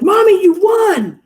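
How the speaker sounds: put-on high, slow excited shout of a teen girl; excited daughter. Daughter calling out to her mom, excited high shout. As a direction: yell the good news like a thrilled kid. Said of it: "She's like".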